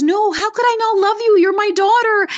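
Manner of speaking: the mother insisting, surprised at the doubt; mother's reassurance. Retold or imitated. Mother reassuring her grown daughter, high and emphatic. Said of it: "And she goes".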